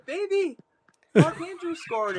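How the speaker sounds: high, loud sing-song cheer to an infant; over-the-top excited daddy voice for the baby; excited baby talk. Retold or imitated. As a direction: squeal the news up high to the baby like it's the best thing ever. Dad cheering touchdown to his baby.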